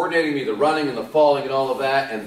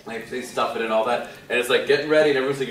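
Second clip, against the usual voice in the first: usual speaking voice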